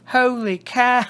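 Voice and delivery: high, loud mock British accent on "Holy cow". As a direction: say "Holy cow" like a hammed-up posh Brit. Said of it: "in a British voice".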